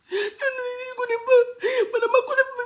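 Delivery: high, loud wailing through the words; hammed-up sobbing imitation of the woman in the video; mock crying woman. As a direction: mimic her crying, half laughing. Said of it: "Viens te montrer comment elle parlait".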